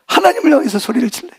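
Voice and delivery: ordinary talk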